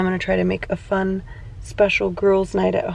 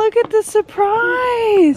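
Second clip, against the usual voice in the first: touched surprise; 11 semitones higher, 5 dB louder, slower than usual. Real, not acted. Mom overwhelmed by surprise gift.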